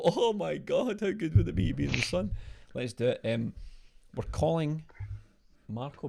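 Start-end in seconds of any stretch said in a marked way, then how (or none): 0.0-2.3 higher, hammed-up radio host delight; radio DJ gush. Imitating the radio host envying the caller's sun, mock gushing. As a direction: gush like a cheesy DJ over the sunshine. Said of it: "And they go".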